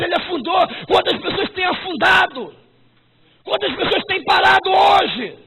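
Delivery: loud, high preacher's cry; full-force preaching. Acted. Pastor challenging the hesitant, loud high preaching. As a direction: hammer each question at the church.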